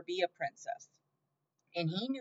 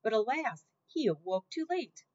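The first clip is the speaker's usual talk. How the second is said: ordinary delivery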